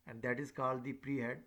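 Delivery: normal talking voice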